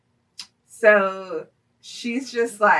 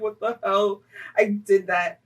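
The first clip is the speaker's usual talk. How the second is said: usual speaking voice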